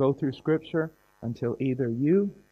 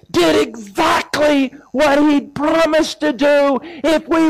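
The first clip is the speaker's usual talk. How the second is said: much higher and louder, slow, near tears; fervent preaching; 11 semitones higher, 8 dB louder, slower than usual. Real, not acted. Preacher telling the congregation the Spirit did it, tearful fervent preaching. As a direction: preach it at full force, overcome.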